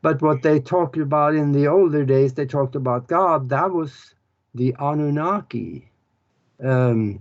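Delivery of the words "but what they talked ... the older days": ordinary delivery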